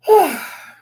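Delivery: loud, high exasperated sigh. Real, not acted. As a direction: let out a big fed-up sigh. Exasperated at the stepmother's plan.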